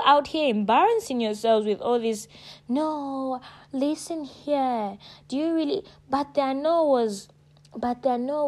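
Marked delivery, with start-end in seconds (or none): 2.7-5.8 mock pushy coaxing. Mimicking a pushy persuader.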